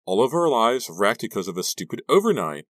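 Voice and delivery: loud, high distressed complaint; upset outburst, pitched high; crying out. A narrator's reading. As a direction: wail over the disaster. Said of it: "Maya cried".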